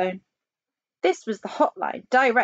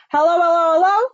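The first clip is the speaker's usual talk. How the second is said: high, loud hellos; rising, insistent phone call; 9 semitones higher, 8 dB louder, faster than usual. Acted. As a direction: shout hello down the line. President calls into phone.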